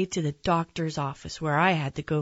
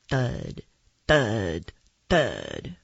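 deep, separated thuds, sinking lower; heavy footsteps; 8 semitones lower, much slower than usual. A narrator's reading. Narrator imitating dinosaurs walking behind, deep heavy thuds. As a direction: drop the voice like giant feet landing.

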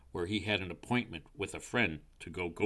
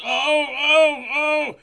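high, loud repeated wails; cries of pain; 13 dB louder, much slower than usual. A narrator's reading. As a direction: wail with a frightful stomachache. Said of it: "most frightful stomachache".

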